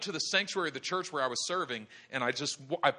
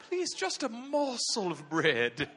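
slow, high British begging; hammed-up British orphan voice; Oliver Twist; 5 semitones higher, slower than usual. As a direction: plead in a mock British accent. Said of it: "the spirit of Oliver Twist came on him".

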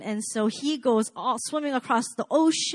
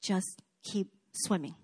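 slow, softer soothing words; calm a panicking friend gently; reassuring Dory line; 3 semitones lower, 8 dB quieter than usual. Retold or imitated. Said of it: "calms him down".

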